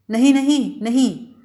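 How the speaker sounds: Aili pushes her back with a half-shouted no. A narrator's reading. Aili protests as she steps toward him, half-shouted cry. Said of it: "ایلی نے شور مچا دیا".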